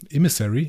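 "Emissary" tried questioningly as "ih-MISS-erry"; "Emissary" with a clean s, unsure; trial pronunciation. Real, not acted. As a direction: test out "Emissary" with an ih at the start and rising end. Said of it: "so wird's ausgesprochen".

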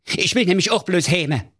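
very high, flustered comic king; a panicking king shouting orders; king losing his head over his missing daughter, high and agitated. Acted.

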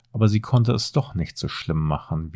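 ordinary delivery, nothing marked

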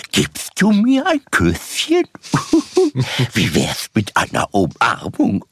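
very high, slow Tigger doll voice; squeaky cartoon tiger toy; talking doll. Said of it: "eine sprechende Puppe".

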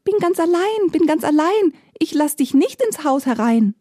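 high, bright little piglet voice, lifted well above the narration; a tiny pig chanting from behind the door; kleines Schweinchen. A narrator's reading. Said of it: "Das Schweinchen aber antwortet". (hohe Ferkelstimme, singend)

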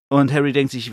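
ordinary talk, nothing marked

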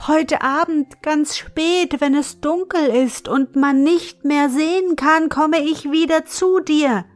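put-on high, sustained girlish call; mild storybook yell, held high; calling at full throat. A narrator's reading. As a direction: pitch it high to carry over a distance. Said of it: "schrie sie aus vollem Halse".

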